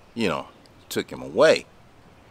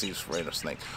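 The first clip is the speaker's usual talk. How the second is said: softer, fading echo of the line; quiet repeat; 5 semitones lower, 13 dB quieter, faster than usual. Real, not acted. Telling about the scared customer, quietly. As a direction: drop it to an aside. Repeats the point, dropping away.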